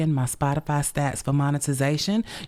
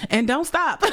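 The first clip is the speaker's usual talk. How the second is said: blurted high through a laugh; 9 semitones higher than usual. Real, not acted. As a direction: laugh it out, delighted. Laughing after heartfelt thanks.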